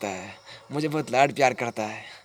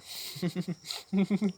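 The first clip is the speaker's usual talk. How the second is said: soft, high-pitched giggle; 7 semitones higher, 5 dB quieter than usual. Acted. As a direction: let out a quiet high laugh. Madman laughs recalling lost love. (धीमी, ऊँची हँसी)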